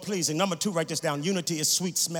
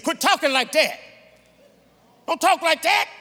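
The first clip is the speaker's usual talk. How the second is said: raised, higher-pitched scolding; Peter's indignant protest, acted out; acted rebuke; 9 semitones higher, 6 dB louder than usual. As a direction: scold a friend in alarm.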